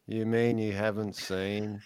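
slow, flat, bored drawl. Shown as a demonstration. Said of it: "in a really bored voice".

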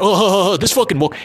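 high, scornful mock complaint; mocking whine. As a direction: sneer it like an annoyed critic.

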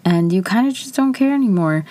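ordinary talk

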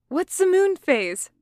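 anxious. A narrator's reading. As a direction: ask it with high-pitched worry. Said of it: "said Patton anxiously".

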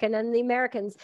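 ordinary talk, nothing marked

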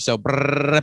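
trilled brrr sound; rolled r. Shown as a demonstration. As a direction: roll the r like a trill. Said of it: "I was pretty good at the brrr".

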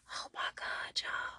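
breathless whispered suspense; tense whisper. Real, not acted. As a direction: whisper it, gripped by the scene. Tense chase scene reaction.

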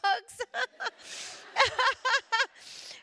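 loud high laugh bursting out; burst of laughter. Real, not acted. Speaker laughs telling the women about her apology. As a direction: crack up at your own joke.

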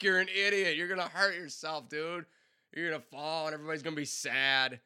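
starts loud and high, fades toward normal; mock scolding. Telling the reckless singer off, loud then calming. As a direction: scold him like a worried friend.

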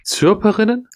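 surprised. Real, not acted. Unbelieving echo of odd word.

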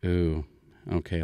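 usual speaking voice

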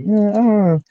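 a high, dubious brush-off; doubtful shrug. Retold or imitated. Reacting to the historian's book with a high skeptical shrug. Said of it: "I'm like".

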